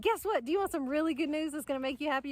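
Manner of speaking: can't wait to tell you; excited news. Retold or imitated. Telling her husband the great news, high and excited.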